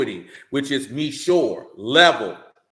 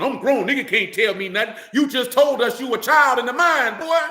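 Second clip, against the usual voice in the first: lightly mocking a stubborn young man; mock defiant youth; 7 semitones higher, 5 dB louder than usual. Preacher mocking a man refusing instruction, loud mock voice. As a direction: imitate a know-it-all brushing off advice. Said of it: "If your first response when somebody giving you good instruction".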